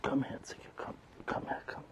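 very soft, voiceless beckoning; coaxing whisper. Real, not acted. Owner coaxing the cat to come, whispering. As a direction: whisper to lure a wary animal closer.

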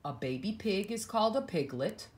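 plain reading voice

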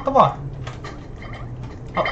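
ordinary talk, nothing marked

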